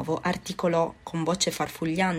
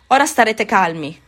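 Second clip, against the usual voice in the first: higher, much louder indignant order; 4 semitones higher, 9 dB louder than usual. A narrator's reading. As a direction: clamp down on the class, outraged. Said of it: "continuava indignato il professore". (il professore ordina alla classe, indignato)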